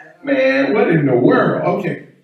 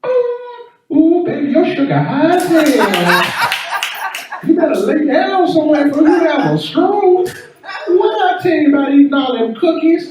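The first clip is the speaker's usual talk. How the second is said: much higher, scolding down-home auntie; sassy auntie voice; 10 semitones higher than usual. Pretend. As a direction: fuss over him like a Southern grandma. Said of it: "hear my talking meter say".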